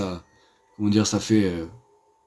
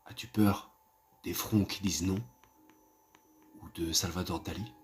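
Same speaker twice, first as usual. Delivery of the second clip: much softer, lower, creepy storyteller; spooky hushed voice; 5 semitones lower, 10 dB quieter than usual. Acted. Asking viewers their greatest fear in a soft, eerie hush. Asks viewers about horror films.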